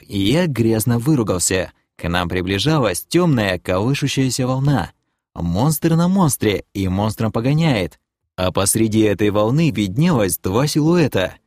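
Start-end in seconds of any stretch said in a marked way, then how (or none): none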